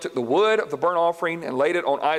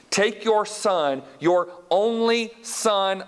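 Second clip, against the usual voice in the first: very slow, each word weighed: Take your son, your only son; slow emphasis; 4 semitones higher, much slower than usual. Shown as a demonstration. Preacher reading the verse very slowly for weight. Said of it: "listen to this wording".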